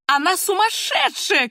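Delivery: high, stretched-out outcry; acted grandmother's high shout. A narrator's reading. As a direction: cry it out slowly, aghast. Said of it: "кричала бабушка".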